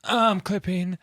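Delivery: moaning voice. Shown as a demonstration. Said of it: "in moaning voice".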